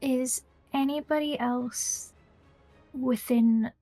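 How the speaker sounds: ordinary talk, nothing marked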